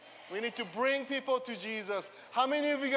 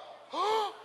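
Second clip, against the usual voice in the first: mock gasp; 8 semitones higher than usual. Shocked at own mock judgement.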